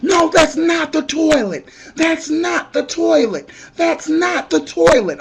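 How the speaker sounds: high, loud panicked warning; panicked yelling. Retold or imitated. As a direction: yell it at him in alarm. Yelling at man using slab.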